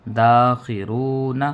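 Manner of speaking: slow, drawn-out spelling of each syllable; spelled out slowly. Shown as a demonstration. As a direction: build the word syllable by syllable, very slowly. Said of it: "یہ سب سے آسان انداز ہے ہجو کرنے کا".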